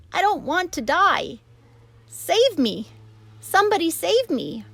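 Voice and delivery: high, loud terrified pleading; panicked. A narrator's reading. Wilbur begs for rescue after the news, high and loud. Pig panicking about death.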